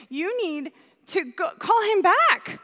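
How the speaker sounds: retold urgent push, voice leaping up; urgent insistence. Retold urging of frantic mother.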